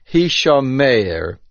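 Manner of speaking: "Hishomer" stressed on the final syllable, "mer" said as "meir"; milra with tzere. Shown as a demonstration. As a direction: say "Hishomer" as hi-sho-MEIR, long final vowel. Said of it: "with a tzere and pronouncing it Milra".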